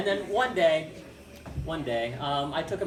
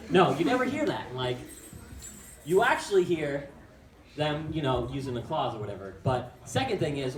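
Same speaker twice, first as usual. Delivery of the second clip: usual speaking voice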